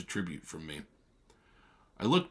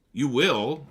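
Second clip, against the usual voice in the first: curt; 6 semitones higher, 8 dB louder than usual. A narrator's reading. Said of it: "I answered shortly".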